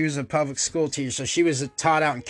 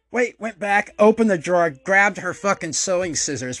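normal talking voice

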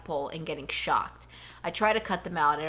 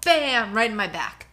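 ordinary delivery

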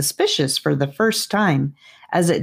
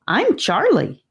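excited; 9 semitones higher than usual. Acted. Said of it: "he answered excitedly".

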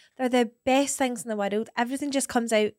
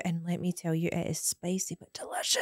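much softer and lower, nearly whispered; hushed aside; 4 semitones lower, 9 dB quieter than usual. Real, not acted. Telling friends about mum's barbecue corn, hushed and low. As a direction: drop to a near whisper.

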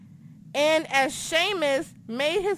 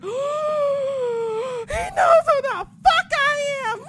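very high, drawn-out fangirl scream; squealing freak-out, stretched and piercingly high; ecstatic screaming; 4 dB louder, much slower than usual. Retold or imitated. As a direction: scream it slowly in overwhelmed excitement. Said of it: "running around your apartment and just screaming".

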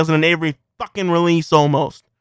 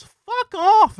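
outraged shout; 13 semitones higher, 3 dB louder than usual. Real, not acted. Gamer ranting at Sims DLC prices, outraged yelp. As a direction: pitch shoots up, a disgusted yelp.

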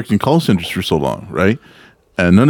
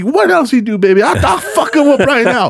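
high-pitched, fired-up egging on; worked-up ally spoiling for a fight; hyping her up; 13 semitones higher than usual. Retold or imitated. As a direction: get indignant on her behalf. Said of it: "I'm hyping her up".

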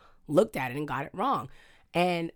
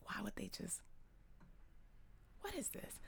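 very soft, confused whisper; hushed puzzled aside; 4 semitones higher, 22 dB quieter than usual. Retold or imitated. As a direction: breathe it out, baffled. Retold confused inner thought.

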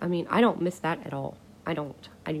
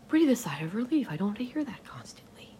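relieved whisper; 4 semitones higher, 3 dB quieter than usual. Real, not acted.

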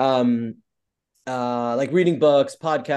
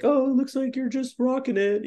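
much higher, cheery voice of an acquaintance; chirpy friend; 13 semitones higher than usual. Retold or imitated. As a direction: gush like someone catching up on LinkedIn. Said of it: "it's always like".

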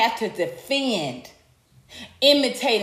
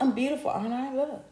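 mock vain beauty queen; 7 dB quieter, faster than usual.

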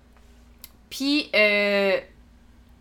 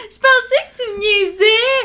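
laughing, higher and louder; 10 semitones higher, 5 dB louder than usual. Real, not acted. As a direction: laugh through the words. Laughing at the mix-up.

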